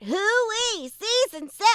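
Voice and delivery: high cartoon voice with a flip; flipping voice. Shown as a demonstration. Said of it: "the flip part".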